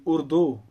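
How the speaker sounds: उर्दू pronounced "ur-doh"; wrong vowel. Shown as a demonstration. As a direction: end उर्दू on a long O, rhyming with "go". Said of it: "और दो नहीं पढ़ते". (उर्दू کے آخر میں "دو" جیسی مجہول آواز)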